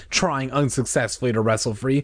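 ordinary delivery, nothing marked

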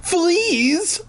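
high shriek of alarm. Acted. Dog discovering fleas, shrieking high. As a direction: shriek it out in panic. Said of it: "I shrieked".

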